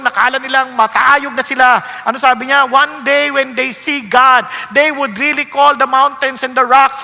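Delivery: loud, driving sermon voice; loud fiery preaching about judgment day; full-force preaching. Acted. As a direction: preach it out to the congregation.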